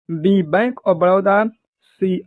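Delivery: ordinary delivery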